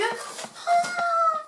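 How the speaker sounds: long, high drawn-out oh; surprised oh. Real, not acted. Vlogger unboxing the next item, drawn-out high surprised oh. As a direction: stretch the oh way up in delight.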